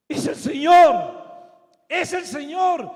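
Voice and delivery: loud, high cry of recognition; excited shout. Acted. John calling out to Pedro that it's the Lord, excited shout. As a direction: shout it out in sudden amazement.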